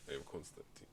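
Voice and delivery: low, soft mock Russian introduction; Russian accent. Job seeker mimicking his rival's introduction, Russian accent. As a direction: imitate a man introducing himself in a Russian accent. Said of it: "he had the accent too".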